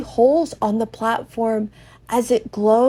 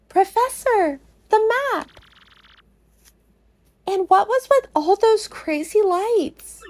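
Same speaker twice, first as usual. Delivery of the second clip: put-on much higher, thrilled girl; excited Goombella; 10 semitones higher than usual. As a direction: point it out to the professor, excited. Goombella excited about the map.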